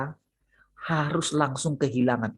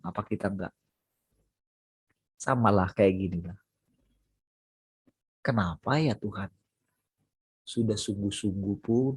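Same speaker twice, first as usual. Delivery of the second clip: softer, lower, pleading to God; mock weary prayer; 4 semitones lower, 4 dB quieter than usual. Preacher voicing a discouraged believer praying, soft and pleading. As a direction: sigh it out as a tired believer asking why.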